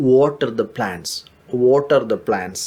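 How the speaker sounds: very slow, careful English drill; slow model phrase. Shown as a demonstration. Teacher modelling the phrase for learners, slow and clear. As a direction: spell it out slowly for the class. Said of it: "ചെടികൾക്ക് വെള്ളം ഒഴിക്കുക".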